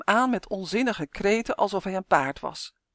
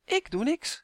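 high, mock-innocent teasing; needling, pitched-up innocence; grinning; 10 semitones higher than usual. A narrator's reading. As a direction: deny it with a sly grin. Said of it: "grijnsde hij".

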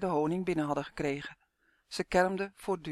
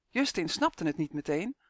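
ordinary delivery, nothing marked

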